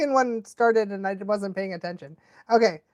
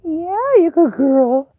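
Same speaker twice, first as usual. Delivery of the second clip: dog praise; 6 semitones higher, 7 dB louder, slower than usual. Real, not acted.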